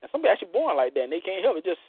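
ordinary talk, nothing marked

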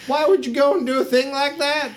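very high, put-on voice, rising question; aghast halfling woman. Halfling woman learning her bird is dead, high outraged voice. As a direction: squeal it in mock outrage. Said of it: "a halfling woman".

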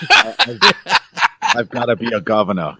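lightly mimicked Austrian action star; joking, hint of an Austrian accent; Arnold Schwarzenegger. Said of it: "Arnold Schwarzenegger in for a cameo".

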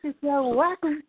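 high-pitched "Wacom" as WACK-um; mocking corny pronunciation. Shown as a demonstration. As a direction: poke fun at "Wacom" with a high "whack-um". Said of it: "'cause it sounds corny".

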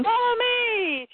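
high, long-drawn mock shout; hammed-up hero's call; acted rallying call. Hero calls friend to flee.

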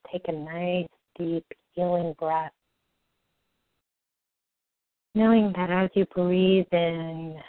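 slow, soft soothing meditation voice; guided meditation. Acted. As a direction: ease them gently into calm.

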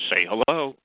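ordinary delivery

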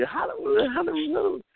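high, drawn-out calling of a name, imitating a happy crowd; mock crowd calling. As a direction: call the nickname out high like friends greeting you. Retelling the crowd calling his name.

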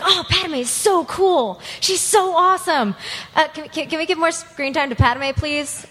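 excited fangirl reaction; gushing fan. Retold or imitated. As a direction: gush over a favorite heroine. Retold gushing as a new fan.

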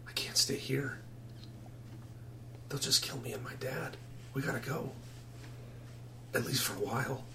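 hushed, near-whispered plea; soft secretive boy; furtive runaway boy. Acted. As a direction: keep it very quiet, barely voiced.

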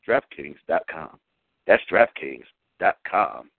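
slow, low announcer sign-off; ad tagline. Acted. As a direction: land the brand name slowly. Ad closing tagline.

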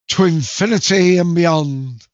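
loud heroic space-ranger cry; heroic Buzz Lightyear. Shown as a demonstration. Quiz host playing Buzz Lightyear, loud and heroic. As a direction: arm out, call it like a toy hero. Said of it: "stretch one arm out and go".

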